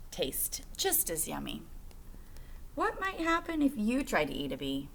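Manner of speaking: normal talking voice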